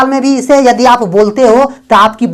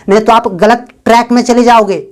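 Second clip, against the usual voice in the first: usual speaking voice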